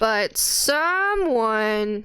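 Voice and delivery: stretched out slowly, pointed; slow accusing drawl. Real, not acted. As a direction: draw out the words to blame.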